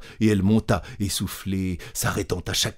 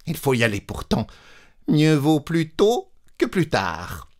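bracing herself; 7 semitones higher than usual. Acted. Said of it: "Elle se dit enfin".

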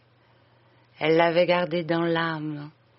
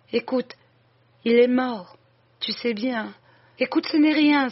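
higher, anguished voice of a dying woman; dying sister's confession; 4 semitones higher than usual. Acted. Dying woman begging her sister and the priest for forgiveness, anguished and higher. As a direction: plead out each line as if breath is running out.

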